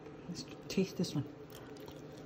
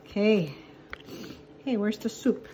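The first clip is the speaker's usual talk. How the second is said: ordinary talk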